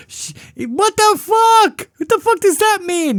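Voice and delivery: incredulous. Retold or imitated. Recalling the sister's warning, stunned high disbelief. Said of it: "own reaction retold".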